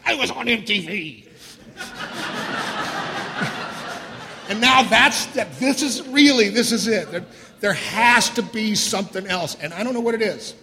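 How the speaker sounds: loud, rueful laughter through the words; laughing. Real, not acted. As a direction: laugh at how absurd it got. Laughing at his own despair.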